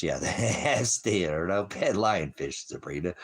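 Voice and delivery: ordinary talk, nothing marked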